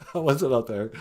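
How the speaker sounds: put-on low, playful old-lady voice answering from upstairs; Nana character voice. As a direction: drop way down to play Nana. Nana voice continues.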